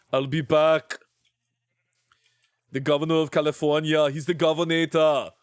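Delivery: Terminator impression with an Austrian accent; Arnold. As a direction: quote the action hero in his accent. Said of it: "sounded like the Terminator".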